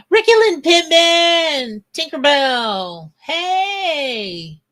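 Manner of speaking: high, loud, drawn-out name call; excited greeting. Real, not acted. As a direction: sing out the names in delighted welcome. Excitedly greeting newly arrived viewers.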